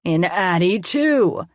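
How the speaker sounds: mock menace rising at the end; funny villain voice. A narrator's reading. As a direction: lift the last word like a play villain. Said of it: "in a funny voice".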